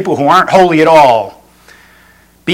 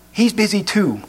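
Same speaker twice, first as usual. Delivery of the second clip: put-on soft, higher little-kid voice; hushed answer from a small child; whispering child; 5 semitones higher, 7 dB quieter than usual. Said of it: "Still whispering".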